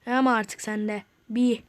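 ordinary talk

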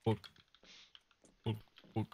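usual speaking voice

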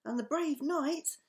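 a mild, animated cry. A narrator's reading. Max is still following the knight's search, crying out. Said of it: "cried Max".

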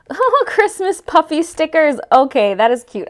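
high, loud excited gushing; excited squeal. Real, not acted. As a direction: gush over something adorable. Excited over stickers.

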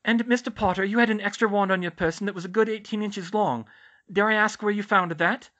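high, loud, inquiring matron; Madame Pomfrey's character voice. Acted. Nurse questioning Harry about a long wand, high character voice.

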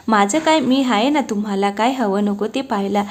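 plain reading voice

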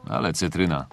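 ordinary talk, nothing marked

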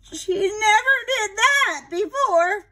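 high, slow, loud dismayed aunt; a fretting old lady in disbelief; upset aunt. Acted. Aunt Sarah protests to the family, high slow and upset.

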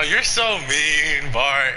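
usual speaking voice

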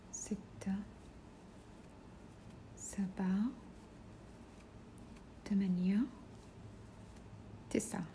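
counting stitches in a soft whisper under her breath. Real, not acted. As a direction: whisper the count to yourself while working. (عدّ الغرز همسًا وبصوت خافت)

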